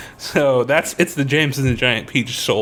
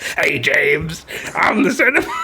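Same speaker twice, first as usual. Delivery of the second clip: blue collar guy. Retold or imitated. Centipede introducing himself to James, hammed-up blue-collar voice. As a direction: play a brash blue-collar cartoon character. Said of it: "he was like a blue collar guy".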